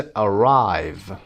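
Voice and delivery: "arrive" with RIVE stretched and punched; a-RRIVE, slow and weighted on the end; stress on second syllable. Shown as a demonstration. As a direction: glide through the A, hold the RIVE in "arrive".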